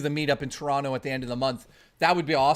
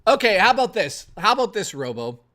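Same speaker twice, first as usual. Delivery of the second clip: pitched up and louder, a sudden idea; excited pitch; 4 semitones higher, 6 dB louder than usual. Real, not acted. Streamer pitches the pie deal to Robo, excited and loud. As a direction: sell the idea with energy.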